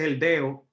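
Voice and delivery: "deo" said without the middle D; dropped D. Shown as a demonstration. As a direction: say "dedo" as "deo". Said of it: "eliminamos la D".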